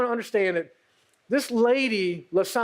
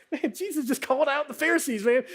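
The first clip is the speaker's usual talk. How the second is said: higher, hushed amazed aside; lightly voiced awed onlooker; impressed bystander; 7 semitones higher than usual. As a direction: marvel like a fan at a game. Crowd reacting to trash talk.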